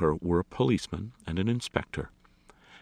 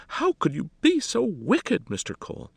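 distraught lady, loud and pitched way up; wailing; 6 dB louder than usual. A narrator's reading. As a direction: wail at him in scandalised distress. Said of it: "wailed Miss Jolly".

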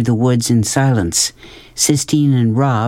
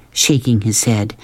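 plain reading voice

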